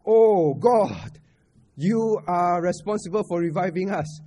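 loud, drawn-out "Oh, God", then easing off; hammed-up appeal, loudest at the start; mock cry to God. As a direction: call out to God in acted complaint. Acted complaint cried out to God.

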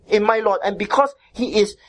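ordinary talk

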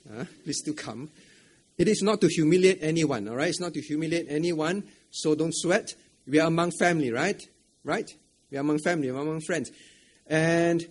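usual speaking voice

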